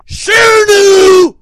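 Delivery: high, full-throated scream of the name; screaming shout-out. Acted. Host screaming a shout-out to his girl, very loud. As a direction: scream it out as loud as you can.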